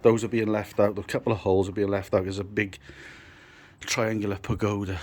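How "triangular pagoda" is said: ordinary talk, nothing marked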